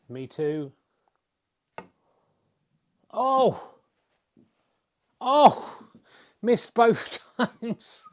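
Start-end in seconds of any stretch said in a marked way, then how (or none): 3.1-5.9 rising, startled oh, oh; surprised shouts. Real, not acted. Two misses in a row. 6.4-8.0 slow, laughing disbelief. Real, not acted. Laughs at the misses.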